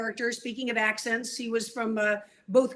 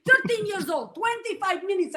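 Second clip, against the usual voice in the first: higher, incredulous Russian with a laugh; Russian accent; 7 semitones higher than usual. Retold or imitated. Natasha brags about her childhood drill, Russian accent. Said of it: "new Russian tennis pro".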